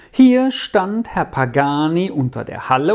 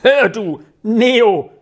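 loud, high angry call; angry raised voice; 6 semitones higher, 5 dB louder, slower than usual. A narrator's reading. Peppino turning on Neo, loud angry call. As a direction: call him out in anger. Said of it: "erhob Peppino seine Stimme, die vor Zorn zitterte".

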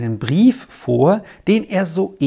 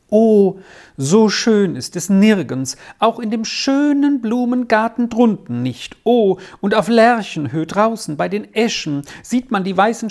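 put-on higher-pitched, swept-up boy describing a beloved place; heartfelt rapture; 7 semitones higher than usual. A narrator's reading. Arthur describes his beloved home to the professor, heartfelt rapture. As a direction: let the longing lift the voice. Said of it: "Dabei ging Arthur das Herz auf".

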